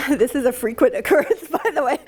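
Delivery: tearful half-laugh through the words; real choked-up voice with a laugh breaking in; emotional. Real, not acted. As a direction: make light of your own tears. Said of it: "I'm very emotional".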